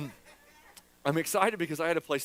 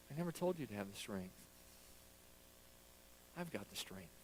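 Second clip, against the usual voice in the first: calm reply as God; 8 semitones lower, 19 dB quieter than usual. Retold or imitated. Voicing God's calm reply.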